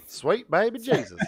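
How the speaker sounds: put-on Southern accent like a revival preacher; hammed-up Southern accent. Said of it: "that's better than mine".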